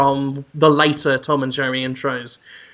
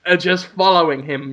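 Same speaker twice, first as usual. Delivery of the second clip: ordinary delivery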